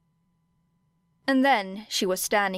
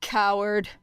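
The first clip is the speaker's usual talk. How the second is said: emotionless. A narrator's reading. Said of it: "without a hint of emotion".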